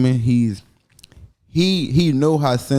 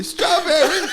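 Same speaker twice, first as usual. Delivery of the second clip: very high laughing squeal; mock moaning woman; slower than usual. Mocking a woman blurting a safe word mid-sex, very high and laughing.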